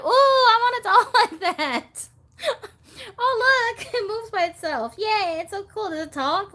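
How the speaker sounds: very high, loud gushing with laughs; squealing delight. Acted. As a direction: squeal like you covet that doll. Acted delight at a moving doll.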